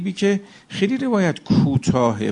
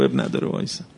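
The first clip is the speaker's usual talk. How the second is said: whisper; 14 semitones lower, 6 dB quieter than usual. Retold or imitated. Guard's reply retold, now whispered.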